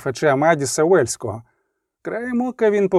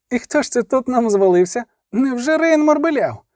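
high-pitched taunting question; boyish, high mock wonder; mocking surprise; 7 semitones higher than usual. A narrator's reading. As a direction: feign amazement, pitch climbing very high. Youngest brother taunting the fallen man.

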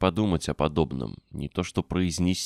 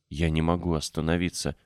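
plain reading voice

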